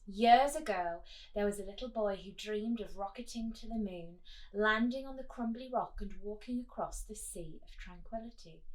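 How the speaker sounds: lively storybook read, rising and falling; reading aloud to children. Acted. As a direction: read it like a bedtime story for kids. Reads blurb to children.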